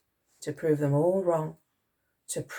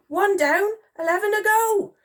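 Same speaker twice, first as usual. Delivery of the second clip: high, loud triumphant count; elated; 5 dB louder than usual. A narrator's reading. Soldier after his first hit, elated. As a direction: call it out proudly.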